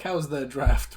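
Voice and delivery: normal talking voice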